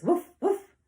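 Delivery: two high, loud, short woofs; dog woof. Acted. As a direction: imitate a dog barking twice. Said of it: "The dog says".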